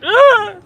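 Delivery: sudden high loud scream; mock falling yell, very high. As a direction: scream as you drop off the roof. Falls off building, screams.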